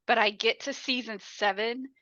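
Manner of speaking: ordinary delivery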